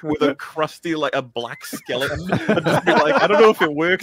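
laughing loudly while talking. Real, not acted. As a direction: crack up mid-sentence. Jokes about returning as skeleton.

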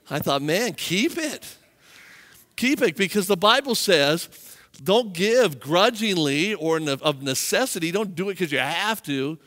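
high, loud revival preaching; preaching at full force. Acted. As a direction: preach it hard to the congregation.

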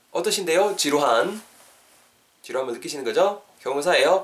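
ordinary delivery

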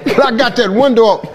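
ordinary talk, nothing marked

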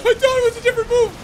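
high, laughing through the words; real helpless laughter over the fight; laughing hard. Real, not acted. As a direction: crack up while explaining. Losing it laughing.

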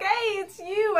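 high, bright eager hey; excited greeting. Retold or imitated. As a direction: greet her like a long-lost friend. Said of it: "He's like".

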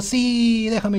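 high, drawn-out playful pleading; mock insisting. Gamer arguing back at the bear in play, mock insisting. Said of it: "Y yo digo".

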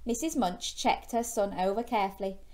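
plain reading voice

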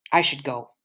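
ordinary delivery, nothing marked

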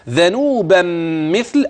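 "ذنوبا مثل" with the n sounds nasalized; nasal ghunna. Shown as a demonstration. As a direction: give "ذنوبا" its nasal hum. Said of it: "أدي الغنة".

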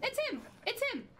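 high, falling, repeated yelps; alarmed squeal. Real, not acted. Streamer spotting the monster, squealing in alarm. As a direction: yelp each warning separately.

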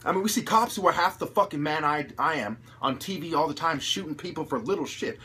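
normal talking voice

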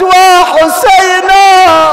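long drawn-out loud wail of وحسينا; crying out. Acted. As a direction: cry out the name in grief, slowly. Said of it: "صاحت".

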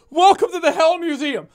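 owl curator voice. Pretend. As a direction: announce the museum grandly. Clean retake of the line.

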